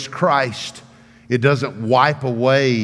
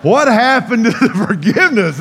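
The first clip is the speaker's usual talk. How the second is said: high, loud mock outrage with laughter; comic indignation; 10 semitones higher, 6 dB louder than usual. Spanked boy protesting lost forgiveness, comically indignant. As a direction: wail it as a hammed-up protest.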